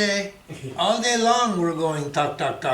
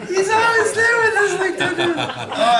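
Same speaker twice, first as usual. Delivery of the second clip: laughing; 9 dB louder, slower than usual. Real, not acted. Laughing after the punchline.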